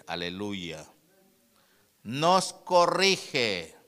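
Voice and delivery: loud preaching with drawn-out key words; prédica enérgica. Real, not acted. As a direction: proclaim the verse loudly, stretching each key word. (prédica fuerte, alargando las palabras)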